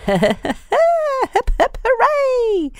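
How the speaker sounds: high squealing cheer with a laugh; hooray cheer. Acted. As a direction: cheer it like a party. Quiz jingle cheer.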